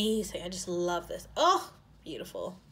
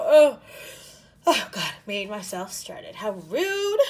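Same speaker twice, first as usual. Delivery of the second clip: surprised; 12 dB louder than usual. Real, not acted. Startled herself.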